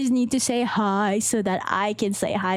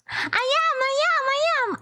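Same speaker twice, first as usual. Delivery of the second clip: high, insistent chant; much higher than usual; strained high voice; 12 semitones higher than usual. Shown as a demonstration. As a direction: push it up high from the diaphragm. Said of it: "to be able to speak like that".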